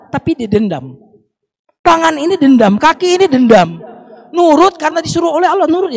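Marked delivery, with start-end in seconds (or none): 1.8-5.9 high, loud sermon emphasis; raised preaching voice; emphatic preaching. Acted. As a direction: drive the warning home. Preacher emphasizing hands' grudge.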